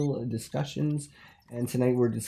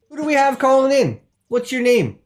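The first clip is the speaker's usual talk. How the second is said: high, loud call-in host question; brightly hustle the caller along; host taking a call on air; eager host; 10 semitones higher, 7 dB louder than usual. Acted.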